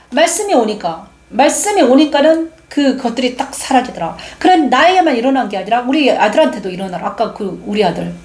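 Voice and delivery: ordinary delivery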